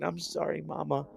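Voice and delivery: high, soft mock complaint; imitated whimpering dog. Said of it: "hearing her cry and complain".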